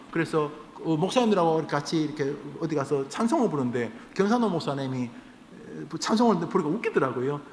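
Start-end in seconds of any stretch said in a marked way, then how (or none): none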